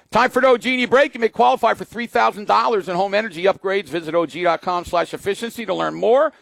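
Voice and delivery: upbeat radio ad read. Acted. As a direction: read the sponsor spot brightly. Live ad read.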